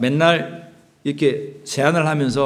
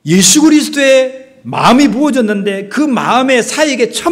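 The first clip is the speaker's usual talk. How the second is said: much higher and louder, preaching at full force; fervent preaching; 9 semitones higher, 7 dB louder than usual. Acted. Preacher pleading with the congregation, loud fervent preaching. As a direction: lift the congregation with rising fervor.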